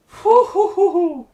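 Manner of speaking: high, loud laughing hoo hoo; real delighted hooting; whooping hoots. Real, not acted. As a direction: hoot with glee at the steal. Celebrating a stolen ball.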